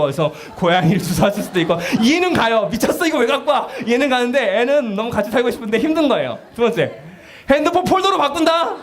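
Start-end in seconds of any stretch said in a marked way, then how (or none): 2.7-3.7 higher, mock-outraged parent; mock shocked parent. Parent refusing the child's pet, mock outrage. 7.5-8.7 much higher, mock-menacing parent; teasing threat. Parent threatening to swap the phone, playful menace.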